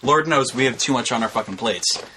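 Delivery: ordinary delivery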